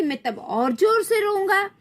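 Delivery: put-on high-pitched childish whine; defiant child. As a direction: say it like a stubborn little boy. Child threatens to cry louder.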